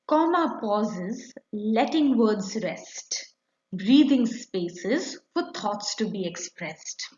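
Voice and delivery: slow, sing-song recitation for a class; measured classroom poem reading; poem recital. Acted. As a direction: recite it slowly as a rhyme for children.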